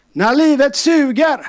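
high, slow, loud refrain; drawn-out preacher's cry; preaching refrain. Acted. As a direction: proclaim it to the church. Repeated refrain, louder.

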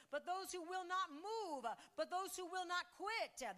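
rising, slow, ringing sermon cry; preaching scripture. Acted. Pastor preaching Isaiah to the congregation, rising fervour. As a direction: build the scripture to a ringing climax.

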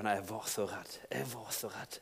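soft, low frightened voice; hushed shaken confession; Peter scared. Pretend. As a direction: shrink into the fear, very quietly. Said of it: "Peter, hva følte du da?".

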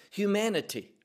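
usual speaking voice

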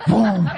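short, loud imitated gunshot; shotgun boom. Preacher mimics shooting people down, loud boom. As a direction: fire the word off. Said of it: "shoot 'em like a pump shotgun".

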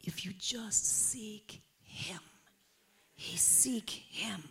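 hushed, slow whisper. Real, not acted. Preacher whispering to the congregation, slow hush. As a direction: breathe the words to the church.

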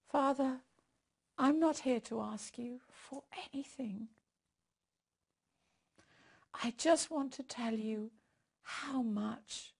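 hushed intimate prayer. Acted. Leads prayer.

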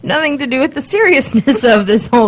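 talking through giggles; words breaking into laughter; laughing. Real, not acted. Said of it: "I'm just trying not to laugh while I'm reading".

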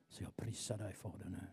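very soft praise; whispered worship. Real, not acted. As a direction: breathe your love to Jesus. Intimate worship prayer.

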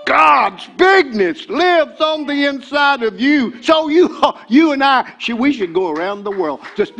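preacher at full force; preaching shout. Real, not acted. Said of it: "fervent preaching shout".

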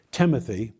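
ordinary talk

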